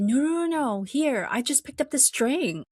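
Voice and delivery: retold indignant protest; protesting innocence. Retold accused man protests innocence.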